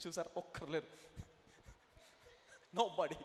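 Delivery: ordinary talk, nothing marked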